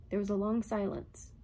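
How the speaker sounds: ordinary delivery, nothing marked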